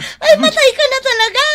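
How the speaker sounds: wife wailing that her husband will die of covid; mock wailing. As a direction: wail that he is surely dying. Wife fears husband dying of covid.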